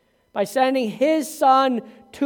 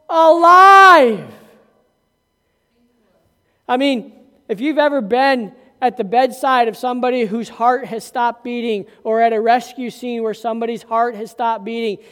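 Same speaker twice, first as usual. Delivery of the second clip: ordinary talk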